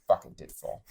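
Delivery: whispering a curse on finding the milk; muttered curse. Retold or imitated. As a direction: concede it under your breath. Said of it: "I was like".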